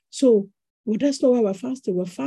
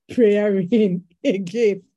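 laughing at herself; 5 dB louder, slower than usual. Real, not acted. Laughs at herself mid-sermon.

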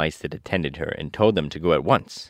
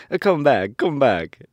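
raised, louder call, falling on each phrase; 8 semitones higher, 6 dB louder, slower than usual. A narrator's reading. Woodman calling the scattered mice back, raised calling voice. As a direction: call after fleeing creatures. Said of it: "he called to the mice".

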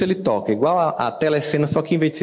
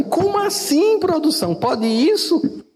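mock astonishment; 13 semitones higher, slower than usual. Mock astonished aside to producer.

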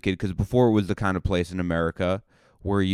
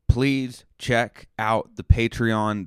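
drawn-out plea, PLEASE leaned on; slow, earnest sales pitch; slow pleading plug; 3 semitones higher, much slower than usual. Acted. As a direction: beg slowly for the Patreon. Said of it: "listen to this plug".

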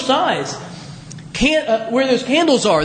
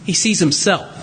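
usual speaking voice

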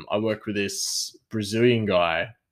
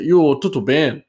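"tudo bem" said in a Brazilian accent, higher and louder; kitchen Portuguese; 6 semitones higher, 7 dB louder than usual. Shown as a demonstration. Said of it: "or you say".

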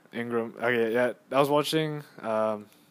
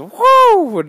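loud, much higher "Woo!" like a cheering crowd; 18 dB louder than usual. Retold or imitated. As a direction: yell it out like the whole arena. Crowd cheering a made shot.

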